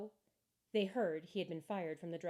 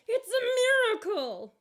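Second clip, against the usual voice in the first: much higher, louder, slow joyous shout; overjoyed exclaim; 13 dB louder than usual. A narrator's reading. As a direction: burst out in wonder. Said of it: "cried the lump of coal".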